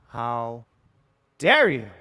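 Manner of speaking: loud punched "dare you?" in hammed-up offence; mock outrage. Streamer hamming up offence at chat, loud mock outrage. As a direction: boom "dare" like fake outrage.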